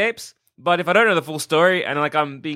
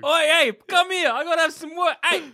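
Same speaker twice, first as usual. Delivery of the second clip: much higher, shouted oi at an ape; mock shouting at ape; 11 semitones higher than usual. Player calling a zoo ape over, high mock shout. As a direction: holler at the ape to come over.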